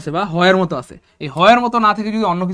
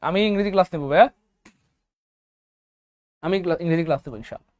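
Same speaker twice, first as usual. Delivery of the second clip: usual speaking voice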